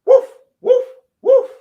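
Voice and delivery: very high, loud yapping woofs; loud playful puppy woofs; dog barks. Acted. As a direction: bark like a little pup.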